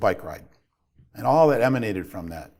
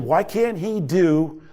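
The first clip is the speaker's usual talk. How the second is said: higher-pitched, exasperated dad reliving his anger; frustrated venting; 6 semitones higher than usual. Retold or imitated. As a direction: plead and scold in one rising-pitched rush of frustration. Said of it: "I was frustrated".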